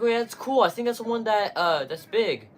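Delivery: his own past reply, pitched well up; own younger self. Retold or imitated. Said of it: "I was like".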